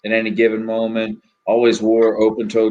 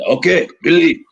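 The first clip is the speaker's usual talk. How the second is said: put-on higher, louder thick French-accented greeting; 7 semitones higher, 5 dB louder than usual. Weed dealer answering Billy's call, thick French accent. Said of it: "Real thick French accent".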